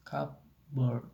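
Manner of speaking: "cupboard" slowly split into cup and board; never-used spelling pronunciation. Shown as a demonstration. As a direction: sound the p in "cupboard", pausing after it. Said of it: "and never".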